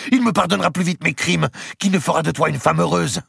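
high, loud, angry comeback; flung retort. A narrator's reading. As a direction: hurl the insult back at her. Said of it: "lui lança Marguerite".

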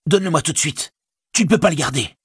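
high, urgent order without much extra volume; changed, demanding voice. A narrator's reading. Ring-obsessed hobbit ordering his servant, high and urgent. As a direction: insist possessively, pitched high. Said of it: "sa voix changea étrangement tout à coup".

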